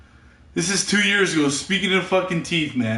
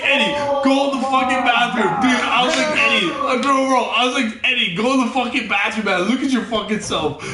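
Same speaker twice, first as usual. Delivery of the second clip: retold urgent yell; 6 semitones higher, 4 dB louder than usual. Retold ordering Eddie to bathroom.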